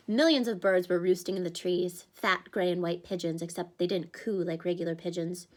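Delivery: ordinary delivery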